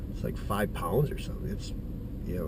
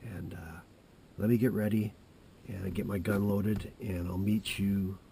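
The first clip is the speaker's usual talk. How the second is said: much softer, held-down voice; hushed voice; 3 semitones lower, 8 dB quieter than usual. Real, not acted. Hunter talking to camera before the hunt, hushed. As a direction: keep the voice down so nothing hears. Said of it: "I am gonna hold my voice down".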